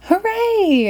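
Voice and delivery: very high cheering hooray. Acted. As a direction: cheer in triumph. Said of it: "everyone cheered".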